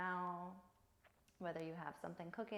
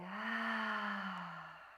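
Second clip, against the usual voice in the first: long open-mouthed sigh letting the breath out; heavy sigh. Shown as a demonstration. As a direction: sigh it all away through the mouth. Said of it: "heavy sigh it away".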